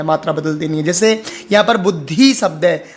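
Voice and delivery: normal talking voice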